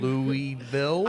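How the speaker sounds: LOUISVILLE drawn out slowly as "LOO-ee-ville", rising; loud, slow, questioning "LOO-ee-VILLE?" for LOUISVILLE; imagined puzzled pastors. Pretend. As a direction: stretch LOUISVILLE into a mock-baffled "LOO-ee-ville?". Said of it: "They're gonna be going".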